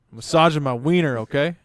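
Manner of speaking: loud, blunt comeback with a smirk; cheeky kid answer. Retold or imitated. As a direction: fire it back, smirking. Son's cheeky answer to dad.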